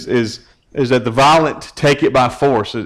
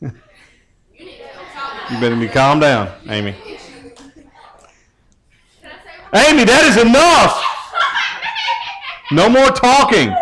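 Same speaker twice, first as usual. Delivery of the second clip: mock stern telling-off with a laugh; playful scolding; 9 semitones higher, slower than usual. Real, not acted. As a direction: tease a chatty listener by pretending to scold her. Scolding a talking congregant, laughing.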